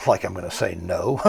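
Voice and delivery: ordinary delivery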